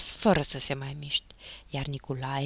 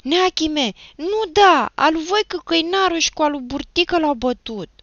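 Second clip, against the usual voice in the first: high, loud panicked pleading; frightened boy in one breathless burst; breathless blurting; 11 semitones higher, 9 dB louder than usual. A narrator's reading. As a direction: blurt out the names to avoid a blow. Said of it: "vorbi pe nărăsuflate".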